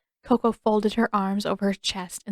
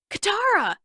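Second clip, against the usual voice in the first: high, bright call of her name; excited shout; 12 semitones higher, 3 dB louder than usual. Acted. Boy calling out to his friend, excited shout. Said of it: "he shouted".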